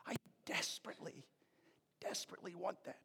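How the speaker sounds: soft, desperate whisper; whispered longing. Real, not acted. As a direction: confess it almost under the breath. Confessing longing for clear signs.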